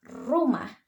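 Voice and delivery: rumah with a strongly trilled R; rumah, tongue vibrating on the R; rolled R. Shown as a demonstration. Student teacher contrasting Indonesian rumah with English, rolled R. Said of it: "The tongue doesn't touch our roof that strongly".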